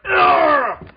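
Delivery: high, loud, drawn-out "argh"; hammed-up climactic roar; mock roaring scream. Superman watching Godzilla hit the wire, mock roar. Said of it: "he's just like".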